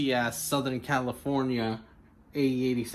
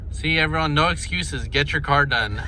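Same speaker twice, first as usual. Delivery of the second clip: shouting; 4 semitones higher, 13 dB louder than usual. Real, not acted. Hyping viewers at swap meet.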